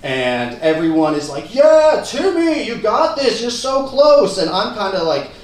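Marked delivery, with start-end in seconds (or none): 1.5-4.4 high, loud crowd encouragement; acted cheering. Everyone cheering the birthday boy up the wall, excitedly.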